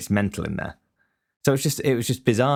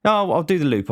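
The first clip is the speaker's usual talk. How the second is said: high, louder mock-polite brush-off; overly polite excuse to the fudge seller; being polite; 9 semitones higher, 4 dB louder than usual. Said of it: "I was being polite".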